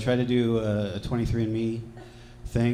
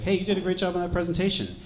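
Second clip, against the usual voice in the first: higher, quick chirpy compliment; mock-encouraging praise; 8 semitones higher, faster than usual. Telling his coworker she did great, mock-peppy. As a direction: reenact an overeager bit of praise.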